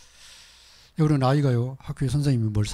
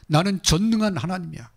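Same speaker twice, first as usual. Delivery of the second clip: higher and louder, each word weighty; booming proclamation; 8 semitones higher, 4 dB louder than usual. Acted. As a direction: proclaim it as God over the flock. God's words repeated, now proclaimed.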